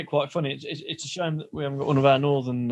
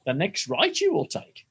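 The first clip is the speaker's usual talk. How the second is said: higher puppet-sage voice; Yoda; 6 semitones higher than usual. Acted. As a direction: lightly impersonate the Star Wars sage. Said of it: "um, Yoda".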